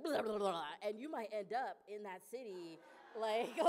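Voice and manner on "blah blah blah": loud babbled gibberish; mock blurting. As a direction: blurt out nonsense syllables at full volume. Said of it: "you just be like".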